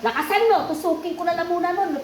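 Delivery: high, loud sharp order; commanding. Real, not acted. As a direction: raise your voice and command. Healer orders a louder answer.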